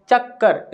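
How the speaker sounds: "चक्कर" said slowly, with the k held long before कर; careful demo. Shown as a demonstration. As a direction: sound out "चक्कर" with a clear doubled k. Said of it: "इस प्रकार से इसका उच्चारण किया जाता है". ("चक्कर" धीरे, क पर ठहराव के साथ)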